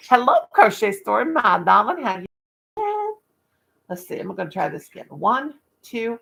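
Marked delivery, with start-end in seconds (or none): 0.1-3.1 louder, sing-song hello to a viewer; bright greeting. Real, not acted. Welcoming a viewer in her live chat, louder cheery hello. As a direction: greet a chat friend warmly.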